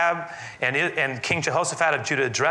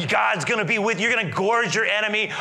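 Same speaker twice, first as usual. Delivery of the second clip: high-pitched, rousing promise; frenzied prophet; 7 semitones higher than usual. Acted. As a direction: whip up the crowd with a prophecy. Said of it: "this kind of frenzied environment".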